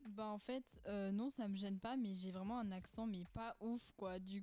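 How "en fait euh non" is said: usual speaking voice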